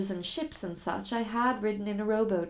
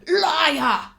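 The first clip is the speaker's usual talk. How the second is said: loud, higher furious snap; furious accusation; 6 semitones higher, 9 dB louder than usual. Acted. Enraged captor accusing his captive, loud. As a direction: spit the word at her.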